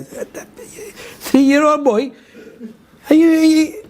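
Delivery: high-pitched, drawn-out disbelief; incredulous. Acted. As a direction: stretch it out high, as if you can't believe it. Acts disbelief at ambassador's claim.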